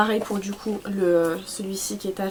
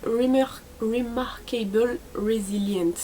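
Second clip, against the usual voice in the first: slow, careful attempt at an English accent; much slower than usual. Shown as a demonstration. Vlogger reading a blush shade name to viewers, attempted English accent. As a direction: struggle through it in a laboured English accent. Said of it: "Quand je fais l'accent anglais, ça va pas".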